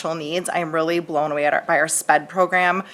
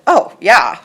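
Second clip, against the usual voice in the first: louder, mock-incredulous kid reply; child's offhand answer; 3 semitones higher, 7 dB louder than usual. Second grader answering mom's odd question, mock-obvious reply. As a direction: answer as if the question were absurd.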